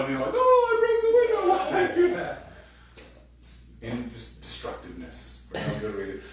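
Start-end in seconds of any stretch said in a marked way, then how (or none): 0.3-2.5 put-on much higher, louder, laughing kid voice; mock childish glee. Child after breaking a window, laughing high kid voice. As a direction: play a gleeful destructive kid.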